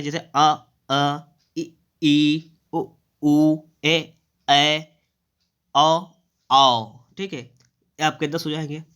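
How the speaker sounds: अ, इ, ई, उ each vowel said clearly and separately; vowel sounds recited. Shown as a demonstration. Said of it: "उच्चारण के आधार पर दस होते हैं". (स्वरों का स्पष्ट उच्चारण)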